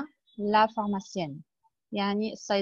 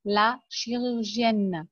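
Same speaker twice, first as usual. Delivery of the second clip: slow model word; much slower than usual. Shown as a demonstration. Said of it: "teacher models word slowly".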